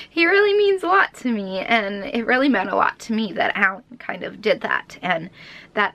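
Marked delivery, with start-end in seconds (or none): 0.1-1.6 high, tearful and tender; emotional. Real, not acted. Talking about the plush toy, high and emotional. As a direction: let the feeling show.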